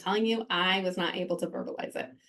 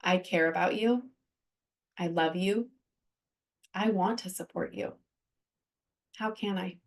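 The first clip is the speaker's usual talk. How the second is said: quietly reassure, with pauses between phrases; tender reassurance; 4 dB quieter than usual. Shown as a demonstration. Showing what a hurting person needs to hear, soft and tender. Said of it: "come alongside me and just say".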